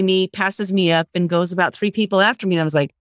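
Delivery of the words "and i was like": ordinary talk, nothing marked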